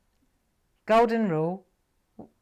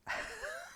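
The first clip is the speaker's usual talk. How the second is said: short high laugh; 13 dB quieter than usual. Real, not acted. Joking about herself.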